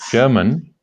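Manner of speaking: ordinary delivery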